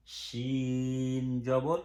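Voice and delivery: সিন জবর stretched very slowly, vowel held long; drawn-out recitation. Shown as a demonstration. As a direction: sing out সিন জবর slowly, holding the sound. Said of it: "সবগুলোর পার্থক্য করতে হবে".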